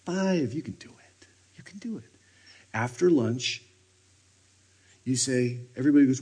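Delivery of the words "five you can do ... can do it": soft encouraging whisper; whispered coaxing. Real, not acted. Preacher encouraging listeners to try five minutes, whispering. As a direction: coax them gently under the breath.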